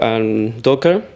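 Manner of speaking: normal talking voice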